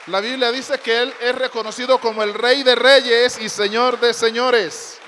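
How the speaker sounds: loud, ringing proclamation; full-force preaching climax; proclaiming loudly. Real, not acted. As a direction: lift the roof declaring the King of Kings. Preacher proclaims Christ as King.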